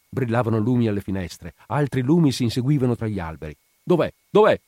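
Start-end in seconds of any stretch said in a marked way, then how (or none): 3.8-4.6 loud, high, quick-fire question; urgent demand. A narrator's reading. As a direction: demand it as the sergeant arriving on the scene. Police sergeant arrives, demands the place. (domanda concitata, a voce alta)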